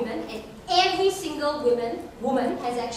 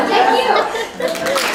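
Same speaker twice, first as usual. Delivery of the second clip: much higher, louder thanks through a laugh; 10 semitones higher, 8 dB louder than usual. Real, not acted. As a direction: laugh it out as you hand over. Laughing handover after overrunning.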